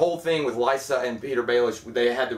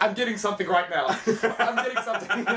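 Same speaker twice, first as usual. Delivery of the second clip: high, laughing quoted bit; laughing imitation; 7 semitones higher than usual. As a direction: imitate the bit while cracking up. Comedian impression, breaking into laughter.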